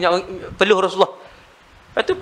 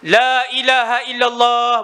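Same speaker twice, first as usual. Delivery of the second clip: loud, drawn-out recitation, pitched higher; 4 semitones higher, slower than usual. Shown as a demonstration. Preacher showing the wrong, loud way to say it, loud. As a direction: call the words out strongly, not as the Prophet did. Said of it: "ni saya sebut kuat".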